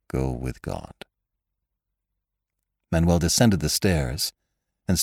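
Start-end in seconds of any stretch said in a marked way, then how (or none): 0.1-1.1 quiet. A narrator's reading. Manuel's soft farewell.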